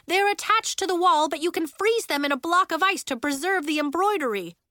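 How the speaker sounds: high, loud, urgent orders; yelling. A narrator's reading. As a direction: yell instructions across the room. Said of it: "Miss Starr was yelling".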